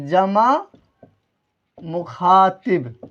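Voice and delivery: very slow, with a long break between words; slow dictation. Shown as a demonstration. Teacher giving students the exam answer, slow dictation. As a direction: stretch out each word for the class.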